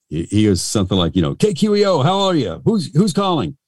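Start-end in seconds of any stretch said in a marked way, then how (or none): 1.3-3.6 high, hyped-up DJ answering the phone; radio DJ imitation. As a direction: greet the caller as a peppy mock DJ. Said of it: "He goes, you know".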